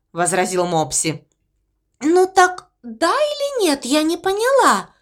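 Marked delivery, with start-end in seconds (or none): 2.0-4.9 squeaky voice. Acted. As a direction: squeak it out dreamily. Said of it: "уточнила овечка". (писклявый голосок)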